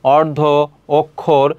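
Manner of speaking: অর্ধ, অক্ষর with an o-like vowel. Shown as a demonstration. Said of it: "ও এর মতো উচ্চারণ".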